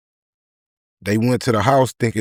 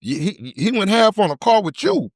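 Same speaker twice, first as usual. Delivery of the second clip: pressing demand; 7 semitones higher than usual. Retold or imitated. Pressing the dude for answers.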